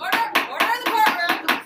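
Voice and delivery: judge banging for order; shouting order order. Acted. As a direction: call the room to order loudly. Judge calling courtroom to order.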